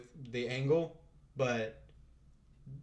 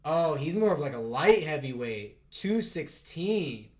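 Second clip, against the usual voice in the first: normal talking voice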